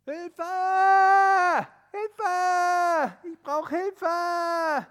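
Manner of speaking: loud high drawn-out cry; calling for help. Acted. Tobi stuck on the ledge, crying loudly for help. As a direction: shout it slowly up the slope.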